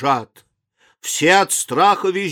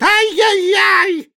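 high, drawn-out wail of alarm; panicked cry; 14 semitones higher, slower than usual. A narrator's reading. Tram passengers cry out at the crocodile, shrieking. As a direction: squeal it out slowly like a frightened crowd. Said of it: "все кричат".

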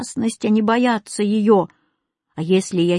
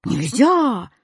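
drawn-out, dismayed wail; sobbing exclamation mark; 3 semitones higher than usual. A narrator's reading. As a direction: wail it in despair. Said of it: "Зарыдал восклицательный". (горестный возглас)

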